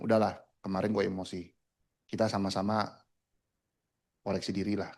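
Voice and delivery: soft, low, retold peace offering; conciliatory uncle. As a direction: imitate an uncle calming things down. Uncle now conciliatory after outburst.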